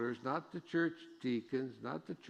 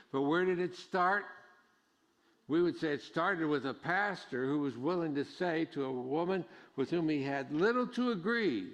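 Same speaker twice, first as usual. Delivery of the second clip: louder, higher preaching, slowing on the key lines; full-force preaching; 4 semitones higher, 5 dB louder than usual. Acted. Pastor urging the congregation to accept outsiders, preaching hard. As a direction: drive the point home from the pulpit.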